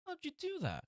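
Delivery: very high, soft puzzled question; baffled officer. Acted. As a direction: squeak it out, baffled. Cop baffled.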